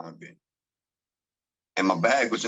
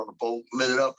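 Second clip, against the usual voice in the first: normal talking voice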